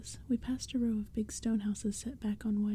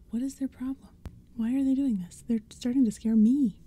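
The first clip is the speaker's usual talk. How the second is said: urgent questions, building louder; alarmed; 3 semitones higher, 7 dB louder than usual. A narrator's reading. Friend getting scared by the pumpkinheads, increasingly alarmed. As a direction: let the worry break out at the end.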